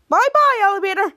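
high, loud mock goodbye; playful farewell. Henry tells friends how the elevator dropped, playful farewell.

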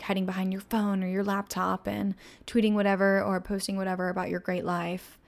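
ordinary talk, nothing marked

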